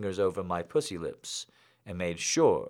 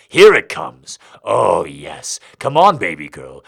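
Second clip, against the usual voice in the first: much louder, straining; loud climax cry; 3 semitones lower, 12 dB louder than usual. A narrator's reading. Daddy at his climax calling to his girl, loud. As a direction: let it burst out at the peak.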